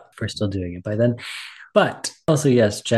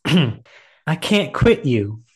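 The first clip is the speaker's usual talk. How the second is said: dramatic movie line; 3 semitones higher, 6 dB louder, much slower than usual. Retold or imitated. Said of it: "and he goes".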